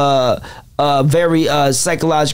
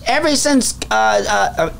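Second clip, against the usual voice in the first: comedian impression, pitched well above own voice; Cat; 7 semitones higher than usual. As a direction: deliver the joke in a high-pitched comic's voice. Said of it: "I'm gonna say it in Cat's voice".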